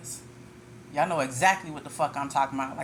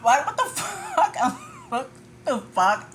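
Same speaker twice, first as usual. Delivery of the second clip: high, slow, laughing through it; laughing disbelief; 3 dB louder, slower than usual. Real, not acted. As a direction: drag it out amused and shocked.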